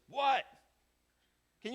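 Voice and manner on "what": loud, higher-pitched snap; irritated "what". Acted. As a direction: yell back as if bothered. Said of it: "the way I think I wanna say it is".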